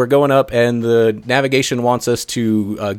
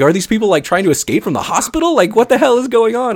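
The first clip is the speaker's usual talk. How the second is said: high-pitched retold bewilderment; freaked out; 11 semitones higher than usual. Driver wondering about escapees from the hospital, freaked out. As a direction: act out baffled alarm, pitch climbing. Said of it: "I was just totally freaked out by this".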